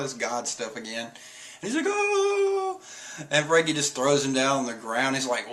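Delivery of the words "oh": very high, long comic wail; mock wail. Caribou about to be thrown, high mock wail. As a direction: let out a high helpless cry.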